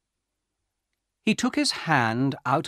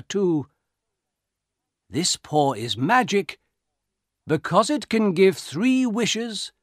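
put-on old soldier, mysterious; 7 semitones higher, 4 dB louder than usual. A narrator's reading.

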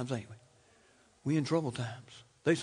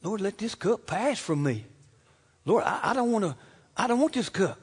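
mild, higher-pitched imitation of pleading; pleading prayer; 4 semitones higher, 3 dB louder than usual. Preacher voicing Jesus praying in the garden, mildly pleading. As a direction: act out a halting plea to the Father. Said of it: "he was crying out to the Father".

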